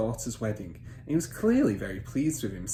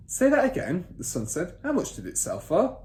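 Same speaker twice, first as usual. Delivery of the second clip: put-on much higher boyish voice; eager son; 8 semitones higher, 4 dB louder than usual. Son asking father to repeat price, high and eager. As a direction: ask it up high like a keen boy.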